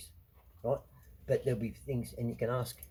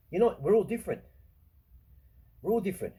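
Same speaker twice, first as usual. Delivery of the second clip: higher, louder insistence; emphatic rant; 8 semitones higher, 7 dB louder, faster than usual. Real, not acted. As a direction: hammer the point home. Ranting against equality.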